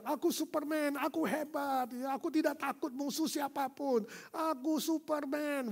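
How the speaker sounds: put-on high, playful child voice; child shouting. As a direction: play the proud little boy.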